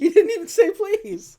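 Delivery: put-on high, loud tattling child; hammed-up childish complaint; mock whining kid. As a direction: whine it like an indignant little kid. Retells kid's complaint, laughing.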